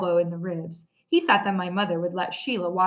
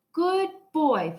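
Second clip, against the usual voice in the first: put-on little kid voice; 8 semitones higher than usual. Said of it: "Fudgy said".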